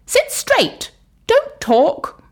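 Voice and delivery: high, stern schoolteacher order; teacher telling pupils to behave; strict teacher voice. Acted. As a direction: scold the class like a strict teacher. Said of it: "telling the class".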